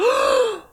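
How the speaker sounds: high shocked mock gasp. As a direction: imitate her scandalized intake of breath. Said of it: "And she's like".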